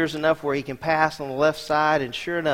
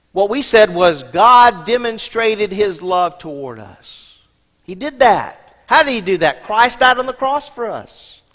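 preaching; 5 semitones higher, 8 dB louder than usual. Acted. Preaching at full force.

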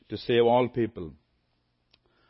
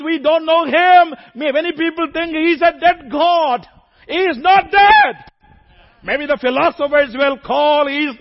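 preaching at full force; 12 semitones higher, 8 dB louder than usual. Acted. Preacher to congregation, fervent loud preaching. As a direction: thunder it out to the congregation.

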